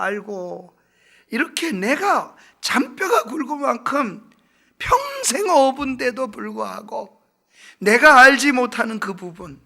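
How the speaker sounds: full-force revival preaching; fiery preaching. Acted. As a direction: preach it to the back row with rising fervour.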